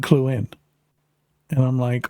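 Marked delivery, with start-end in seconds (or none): none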